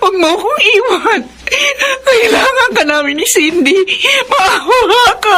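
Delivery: wife sobbing as she begs Robert; tearful pleading. Acted. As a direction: beg him to stay through sobs. Wife begs husband not to leave.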